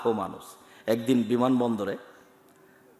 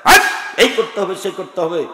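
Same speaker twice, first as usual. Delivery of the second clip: commander's rebuke; 4 semitones higher, 7 dB louder than usual. Retold or imitated. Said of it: "যেন তিনি সৈন্যদলকে ধমক দেখাচ্ছেন".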